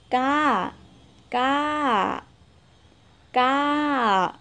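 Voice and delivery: ca said level but clearly high. Shown as a demonstration. Teacher showing the level high Lao tone. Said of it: "Giọng hẳn cao, âm cao".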